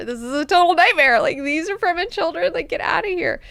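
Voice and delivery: high, loud, fast rant of disgust; exasperated outburst. Retold or imitated. Duncan ranting loudly about the fake Fremen children. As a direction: blow up at the sheer fakery.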